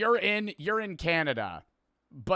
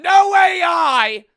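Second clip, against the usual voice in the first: long, drawn-out high yell; emphatic shout; 12 semitones higher, 15 dB louder, slower than usual. Real, not acted. Streamer showing viewers a merch design, emphatic shout. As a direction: bellow it slowly and proudly. Boasting design is not AI made.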